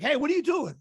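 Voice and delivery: high, loud startled question; alarmed challenge. Retold or imitated. Man catches him heading for the back room, alarmed challenge. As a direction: challenge an intruder, imitating the alarm.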